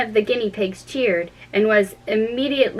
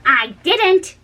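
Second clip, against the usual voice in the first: quick, raised denial; hurried; 9 semitones higher, 5 dB louder than usual. A narrator's reading. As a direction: blurt it out fast. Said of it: "in a great hurry".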